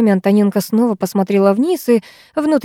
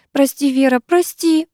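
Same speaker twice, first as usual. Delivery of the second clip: higher, tearful pleading apology; swallowing tears; 6 semitones higher than usual. A narrator's reading. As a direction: Lyusya chokes back tears apologising to Vera, tearful. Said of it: "глотала слёзы девчонка".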